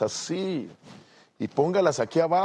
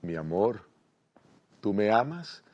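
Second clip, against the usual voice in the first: tierno al oído; 4 semitones lower, 3 dB quieter than usual. Acted. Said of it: "le dice al oído con ternura".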